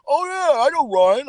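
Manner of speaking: high mock fan impression. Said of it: "They're like".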